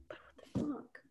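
voiceless whisper, mock bafflement; whispered disbelief. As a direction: whisper it as a baffled viewer's reaction. Voices a baffled viewer's reaction.

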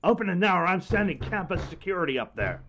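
furious guard yelling; angry yelling. Acted. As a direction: bang on the door with words. Guard shouting at closed hatch.

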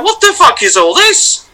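high, slow, disbelieving cry; shocked yell. Retold or imitated. Among masked friends, a high stunned yell. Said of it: "And I was like".

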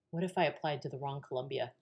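soft, quick worried thought; quiet worry. Retold or imitated. Daughter wondering about the wrong Columbia, quietly worried.

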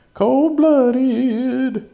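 high squeaky shouted impression; Dave Chappelle quote. Quoting a comedy bit to listeners, high loud yell. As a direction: belt it like a comic's catchphrase. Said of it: "It's from Dave Chappelle".

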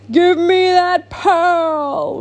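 slow, drawn-out menacing monster roar; roaring eel. Acted. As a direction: roar it slowly like a big sea monster. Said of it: "roared the eel".

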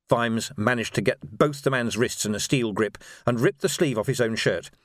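usual speaking voice

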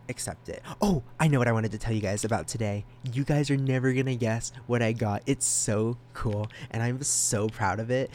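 ordinary talk, nothing marked

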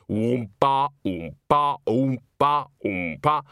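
steady two-beat tuba imitation; imitated tuba oompah. A narrator's reading. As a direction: plod out a mechanical oom-pah.

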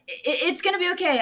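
high, urgent reassurance. Acted. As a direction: raise your voice to reassure. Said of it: "raises his voice at them".

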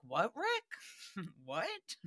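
soft baffled what with a laugh; softly puzzled, laughing a little. Retold or imitated. Hosts answering Rick, softly baffled and laughing. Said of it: "And we're like".

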